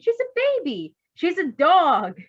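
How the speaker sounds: high, loud, slow insistence; exasperated retort. Acted. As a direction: drag it out, fed up. Bluebird retorts, drawn out.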